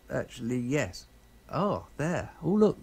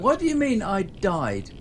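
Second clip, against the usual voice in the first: surprised; 7 semitones higher, 5 dB louder than usual. Real, not acted. Sudden in-game death, disbelief.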